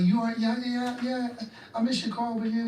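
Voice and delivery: high, casual brush-off; girlfriend on phone. Retold or imitated. Girlfriend to jailed caller, high casual brush-off. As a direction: imitate a woman breezily moving on. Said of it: "She just like".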